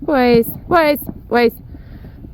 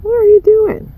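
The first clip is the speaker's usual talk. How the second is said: exasperated pet talk; 10 semitones higher than usual. Real, not acted. Owner playfully scolds her goat for chewing a stick.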